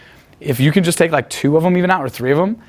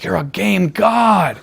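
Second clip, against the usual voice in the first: slow, drawn-out, slightly higher amazement; imitated awe; 4 semitones higher, much slower than usual. Guys marvelling at the speaker's success, imitated awe. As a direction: mimic impressed guys, dragging each word.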